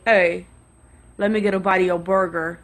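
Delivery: low-voiced guy making a demand; imitated gruff demand. Voicing the man demanding a bite.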